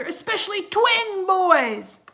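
loud, stretched-out menacing cry; high, swelling ghost-story climax; scary relish. A narrator's reading. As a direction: drag it out to terrify them. Scaring the twins.

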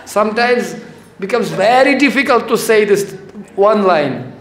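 normal talking voice

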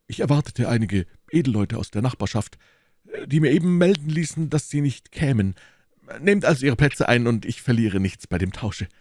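high, lively musketeer voice; animated host covering for himself; blustering host. Acted. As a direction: explain away your feast grandly. Reader voices the host explaining.

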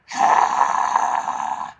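loud airy sigh on an H; breathy H sigh demo. Shown as a demonstration. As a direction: push out an open H sigh. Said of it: "make the H sort of sound like".

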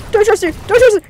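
high, loud panicked cry; panicked shout. Real, not acted. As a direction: shout it out in alarm. Repeated shout in game.

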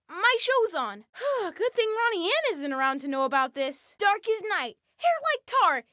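normal talking voice

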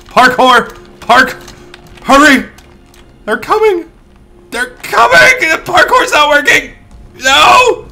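high, loud shouts rising to a scream; panicked yelling. Real, not acted. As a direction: yell frantically as the escape fails. Fleeing uphill from trolls.

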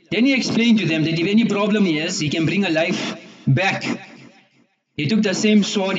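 ordinary delivery, nothing marked

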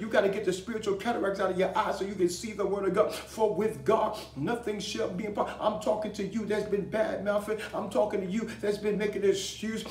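impassioned rallying of the congregation; full-force preaching. Real, not acted. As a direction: preach it hard, punching each phrase.

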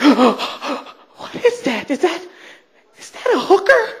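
high, scandalized voice climbing at the end; mock shocked friend. Friend from North Carolina spots someone downtown, mock shocked.

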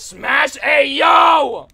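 high, loud, drawn-out yell; mock-shocked holler. Real, not acted. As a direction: stretch it into a big playful shout. Mock shock reading chat message.

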